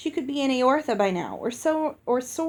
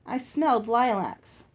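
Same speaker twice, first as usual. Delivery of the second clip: ordinary delivery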